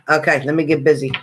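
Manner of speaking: ordinary delivery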